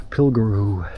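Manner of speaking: usual speaking voice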